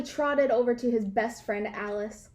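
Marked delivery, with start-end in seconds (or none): none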